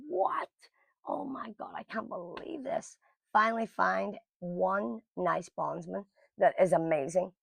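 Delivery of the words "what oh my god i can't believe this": a high gasp, then a panicked whisper. Retold or imitated. Learning no bondsman will take her, panicked whisper. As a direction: squeak the What, then hush to a breathless whisper. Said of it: "I am in panic mode".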